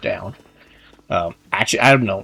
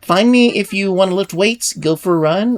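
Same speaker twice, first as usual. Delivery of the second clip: higher, louder personal trainer character; Scoot; 5 semitones higher, 3 dB louder than usual. Acted. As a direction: play a fitness coach showing off pro vibes. Voices Scoot's game dialogue.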